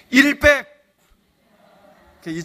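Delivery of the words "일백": loud, high punch on the answer; triumphant reveal. Acted. Preacher reveals the coin to the congregation, loud and high. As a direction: slam the punchline home.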